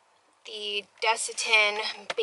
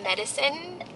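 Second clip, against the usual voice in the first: normal talking voice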